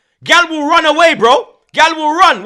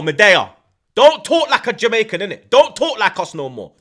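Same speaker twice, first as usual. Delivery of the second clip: usual speaking voice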